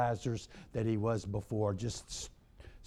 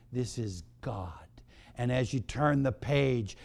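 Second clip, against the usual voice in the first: normal talking voice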